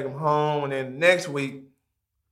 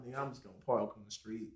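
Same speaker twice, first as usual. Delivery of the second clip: quiet; 6 semitones lower, 20 dB quieter, much faster than usual. Retold or imitated. Nervous quote, very soft fast.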